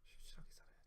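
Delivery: hushed whisper. Real, not acted. Preacher to congregation, answering his own question in a whisper. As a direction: breathe the answer almost silently. Answer after long pause.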